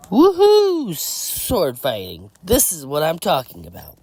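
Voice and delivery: high, whooping excitable voice. Acted. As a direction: open on a squealed whoop, stay high. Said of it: "The higher voice in my head says".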